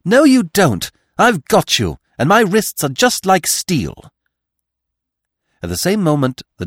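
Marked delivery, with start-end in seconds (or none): 0.0-4.1 higher and louder, defiant and ringing; a girl crying out as she seizes the attacker; clear, strong call. A narrator's reading. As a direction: shout it out as she grabs the attacker. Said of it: "in Cinderella's clear tones".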